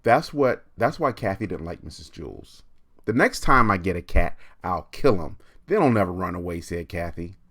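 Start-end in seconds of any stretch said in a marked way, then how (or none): 3.1-6.6 put-on grim bratty girl. Kathy vows about the next cat.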